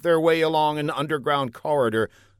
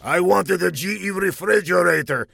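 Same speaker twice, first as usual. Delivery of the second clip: spat; 7 semitones higher, 3 dB louder than usual. Acted. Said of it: "he spat".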